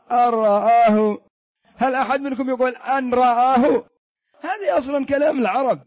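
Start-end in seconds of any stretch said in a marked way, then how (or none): 0.1-1.2 أن رآه slow and loud, noon merged: "ar-ra'ah". Shown as a demonstration. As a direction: roll straight into the ra of أن رآه. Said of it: "انطقوا مثلي". (إدغام النون في الراء بصوت عالٍ وبطء)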